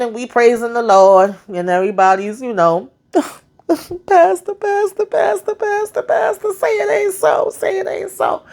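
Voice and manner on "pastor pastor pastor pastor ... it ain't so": high, wailing cry of pastor; mock mourner wail. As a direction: wail like a grieving churchgoer.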